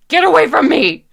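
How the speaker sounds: sudden high, full-force yell; desperate shout. Acted. Character losing control at a friend, desperate scream. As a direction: burst out screaming to drive them off.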